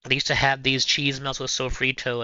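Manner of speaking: ordinary talk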